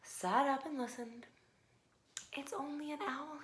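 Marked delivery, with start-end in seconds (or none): 2.3-3.4 soft tired whisper; sleepy whisper. A narrator's reading. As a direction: mumble it half asleep. Sleepy Big Mouse reassuring.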